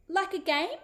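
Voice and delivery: put-on hopeful child. A narrator's reading. Little girl brightens.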